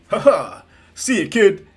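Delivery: loud, high hero laugh and farewell; All Might. Acted. Hero leaving a young fan, loud and hearty. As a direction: boom a laughing goodbye. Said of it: "And he goes".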